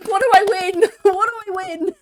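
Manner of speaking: high, loud gleeful crowing, laughing; mock triumph. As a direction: crow like a bingo winner. Crows over winning bingo guess.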